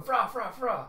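high, loud repeated squawk; animal cry. Acted. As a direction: bark like an excitable little creature. Imitates the pet's cries.

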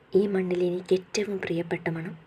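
hushed, breathy whisper of longing; a private whispered thought; soft whisper. Acted. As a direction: whisper it to yourself, lost in the scent. Savoring his scent alone.

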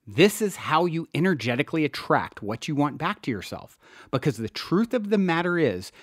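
usual speaking voice

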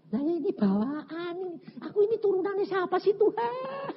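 very high, hammed-up tearful complaint; mock wailing. Preacher laments his inherited weakness to God, mock wailing.

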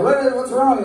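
high startled what's wrong; mock startled question; surprised. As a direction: jump up in pitch, caught off guard. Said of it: "I was like".

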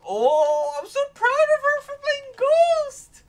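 very high, slow, wailing fake sob; hammed-up teary pride; mock crying. Streamer proud of a friend, pretending to cry. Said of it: "I'm trying to cry here".

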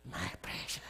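low, rasping whispered demon voice; strange demon voice. Pretend. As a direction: hiss it like a possessed girl. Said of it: "she starts talking in strange, with strange voices".

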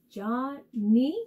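"Johnny" in two separated syllables. Shown as a demonstration. Teacher confirming the count for kids, "Johnny" syllable by syllable. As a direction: say "Johnny" as two distinct beats. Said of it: "fun to clap out the syllables".

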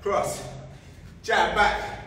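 normal talking voice

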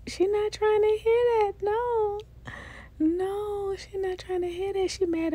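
put-on high sing-song baby talk to the doll. Vlogger telling the doll to say bye, high baby talk. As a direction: talk to her like a fussy toddler.

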